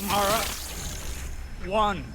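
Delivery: sudden excited insight; sudden realization. Acted. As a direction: call her name as it clicks. Sudden realization mid-conversation.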